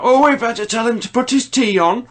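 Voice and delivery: high, loud mock shout of a woman; shrill-free but high shouted woman's voice, hammed up; imitating a loud woman. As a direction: mimic a loud woman yelling across the bus. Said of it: "a very loud woman who was shouting".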